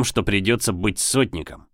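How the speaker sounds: usual speaking voice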